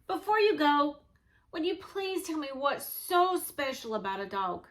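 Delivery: usual speaking voice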